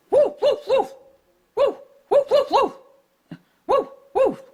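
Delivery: high yappy woof, barked out; dog barking. Acted. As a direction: bark like a small excited dog.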